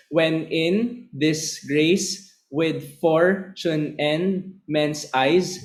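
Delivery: each syllable said apart, very slowly; slow scansion for counting. Shown as a demonstration. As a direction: count it out beat by beat. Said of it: "Bilangin nga natin ulit kung ilan yung syllables".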